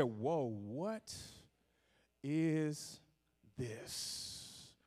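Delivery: slow, hushed wonder, trailing almost to a whisper; awed amazement. Acted. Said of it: "you say".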